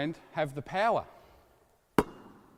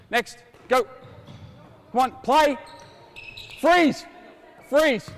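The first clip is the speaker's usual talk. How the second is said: loud, higher shouted commands; coach's shout; 7 semitones higher, 5 dB louder, slower than usual. Real, not acted. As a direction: bark the freeze across the court. Coach shouting commands mid drill.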